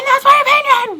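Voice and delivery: high, loud mock declaration; mock defiant. Arguer throwing an opinion on somebody, mock defiant.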